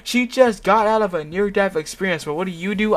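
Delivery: a touch of growling fury; angry growl. Acted. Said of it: "I growl".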